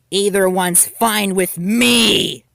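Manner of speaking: loud, drawn-out bullying threat; menacing shout. Acted. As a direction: drag it out as you advance on her. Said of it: "shouted Nibutani".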